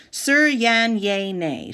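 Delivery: "Sir Yan Ye Ne" said slowly, broken into stiff syllables; mangled name. Retold or imitated. Teacher fumbling the new boy's name, slow and careful. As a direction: stumble through "Sir Yan Ye Ne" like a foreign word. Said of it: "attempts to say it out loud".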